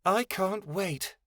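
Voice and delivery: high, slow moan; whiny moaned complaint. A narrator's reading. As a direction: drag it out in a high-pitched moan. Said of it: "Snowdrop moaned".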